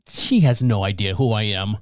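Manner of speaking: normal talking voice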